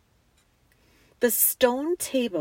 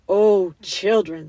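loud, slow, grand call; exultant lion; 7 dB louder, slower than usual. A narrator's reading. Lion to the children as strength returns, exultant. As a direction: call out with rising power.

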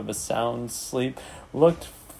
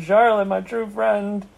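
grief-stricken, higher-pitched moan; 7 semitones higher than usual. A narrator's reading. Pig grieving for his dying friend, moaning. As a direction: wail to a dearest friend. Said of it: "he moaned".

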